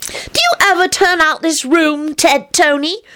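put-on high-pitched curious little kid; high, wide-eyed child voice; rapturous child. A narrator's reading. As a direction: ask in wonder like a small child. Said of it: "Teddy inquired as he gazed about".

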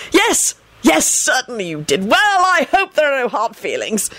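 high, flustered appeasement; desperate blurting. A narrator's reading. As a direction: blurt it out desperately, pitch jumping up. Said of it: "he blurted out desperately".

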